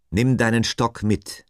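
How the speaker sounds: emphatic divine instruction; God, instructing. A narrator's reading. God tells Moses to take his staff, emphatic.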